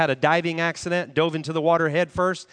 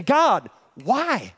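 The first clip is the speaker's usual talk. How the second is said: anguished cry to God; 4 semitones higher than usual. Retold or imitated. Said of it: "And saying".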